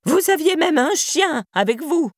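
put-on high, drawn-out old-woman cry; high-pitched accusing call; shouting old woman. A narrator's reading. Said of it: "cria la vieille derrière lui".

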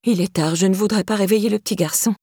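ordinary delivery

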